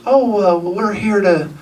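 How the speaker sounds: high Southern woman, slowing at the end; hesitant, high-pitched Southern impersonation; imitated southern accent. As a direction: mimic her hedging Southern answer, with hesitations. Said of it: "She said it just like that".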